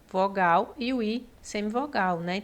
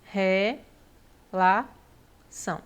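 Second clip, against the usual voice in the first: uma sílaba de cada vez; separação silábica; 2 semitones lower, slower than usual. Shown as a demonstration. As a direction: longas pausas entre as sílabas, como num ditado. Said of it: "Então, vai ficar aqui". (sílaba por sílaba)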